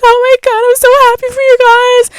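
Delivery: very high excited squeal of delight; thrilled friend. Retold or imitated. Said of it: "she loved it".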